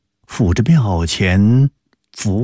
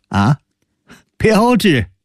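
high-pitched incredulous echo; 11 semitones higher, slower than usual. Acted. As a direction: question it in mocking disbelief, pitch leaping. 高士奇听说标致，惊讶嘲笑. (难以置信地高声反问)

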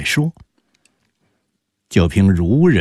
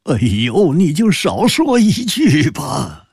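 pleading, with a tremor of tears, pitched higher; tearful voice; 9 semitones higher than usual. A narrator's reading. Squire begging his wife to say no more, tearful and high. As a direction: beg her to stop, close to tears. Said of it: "带着哭音儿说".

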